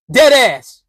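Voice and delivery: ordinary talk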